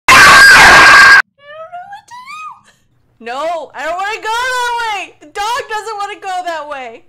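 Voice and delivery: terrified scream. Real, not acted. Gamer cornered in the mine, terrified crying scream. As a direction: scream out of real fright.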